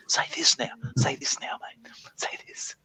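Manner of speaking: hushed feeding of lines; whispering. Retold or imitated. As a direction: whisper the prompts urgently. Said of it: "keep feeding people the words to say".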